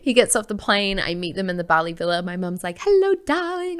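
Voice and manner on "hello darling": much higher, sing-song hello; happy mum. Retold or imitated. Daughter imitating her happy mum greeting her at the villa, high and sweet.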